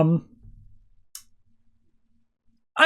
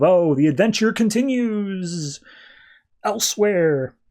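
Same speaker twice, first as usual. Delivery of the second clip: higher, slow trailer-voice send-up; mock announcer; 5 semitones higher, slower than usual. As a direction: ham it up like a to-be-continued tag. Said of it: "we got this thing of".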